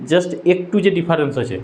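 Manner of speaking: ordinary delivery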